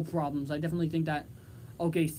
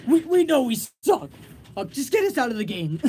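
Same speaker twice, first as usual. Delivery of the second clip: mock shout; 5 semitones higher, 7 dB louder than usual. Acting out players quitting.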